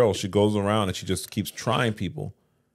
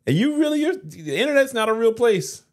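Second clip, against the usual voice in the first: much higher, lecturing the audience; raised-voice rant; 9 semitones higher than usual. Real, not acted. Host warning viewers the internet isn't real, in a high raised rant.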